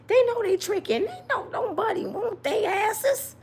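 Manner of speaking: exasperated comic rant; rising exasperation. Real, not acted. Ranting about the men.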